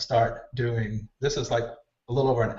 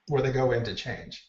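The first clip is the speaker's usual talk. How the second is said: usual speaking voice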